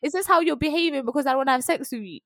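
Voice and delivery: high, fast indignant challenge; retold angry confrontation. Acts out confronting him.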